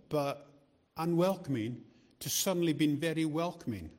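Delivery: usual speaking voice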